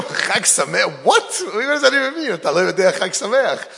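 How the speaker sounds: high, loud disbelieving cry, rushing the last line; shocked outburst. Retold or imitated. Doctor in disbelief at the unknown holiday greeting, shocked high shout.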